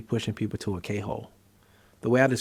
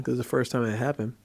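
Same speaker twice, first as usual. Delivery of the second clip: usual speaking voice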